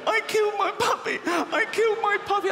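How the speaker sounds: high, loud mock-wailing kid; distraught child to uncle. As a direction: howl it like a guilty child. Kids wailing confession.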